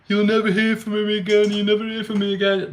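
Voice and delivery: lightly hammed-up imitation of a bragging fighter; mocking Tony Bellew. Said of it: "he was going".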